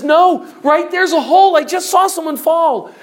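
high, loud acted alarm; alarmed cry to stop her; urgent warning. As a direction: call out a frantic warning. Warning woman about the hole.

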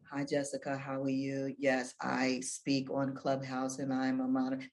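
low, soft, bored delivery; dull monotone. Shown as a demonstration. S0 demonstrating the dull Clubhouse intro, low and bored. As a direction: show how boring it sounds. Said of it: "Nobody wants to hear that".

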